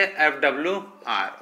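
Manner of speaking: FWR spelled out slowly and softly as a pet name; fond nickname. Shown as a demonstration. Teacher to class, giving the nickname, slow and soft. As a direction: drawl the short name so the class writes it down. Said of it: "इसको मैं क्या बोलूंगा प्यार से".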